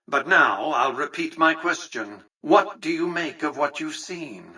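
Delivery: ordinary talk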